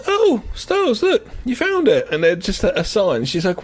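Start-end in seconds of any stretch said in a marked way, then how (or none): 0.1-2.1 much higher marvelling voice; mock wonder. Voicing her looking up at the stars, high mock wonder. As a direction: act out a waking, wide-eyed discovery.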